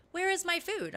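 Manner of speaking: high-pitched mock demand; demanding cat voice. Cat to owner at five in the morning, mock demanding. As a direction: lightly voice a cat wanting breakfast. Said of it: "so she's like".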